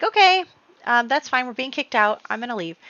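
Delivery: high, loud singsong okay, easing down; mock breezy acceptance. [name] being kicked out, airily unbothered.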